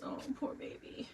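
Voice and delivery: whispered mock sympathy; mock pity. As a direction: coo fake pity. Mock pity over vasectomy joke.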